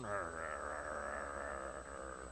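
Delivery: low, stewing growls under the breath; lying awake, growling at your partner; angry growling. Retold or imitated. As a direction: seethe in bed with low growls. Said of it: "you're just sitting there going".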